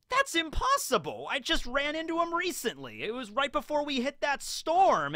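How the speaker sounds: high-pitched, incredulous acted protest; disbelief. Acts out a disbelieving Muchigoro.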